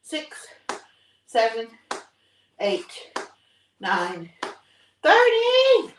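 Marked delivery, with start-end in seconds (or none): 5.0-5.9 loud, high final count; shouted count. Real, not acted. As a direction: belt out the last number in triumph.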